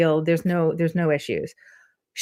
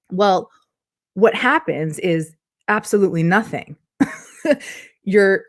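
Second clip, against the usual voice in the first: usual speaking voice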